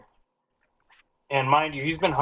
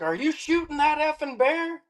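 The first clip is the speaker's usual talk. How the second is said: much higher, outraged buddy on the radio; mock angry radio yell; 14 semitones higher than usual. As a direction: demand it in disbelief. Buddy yelling over the radio.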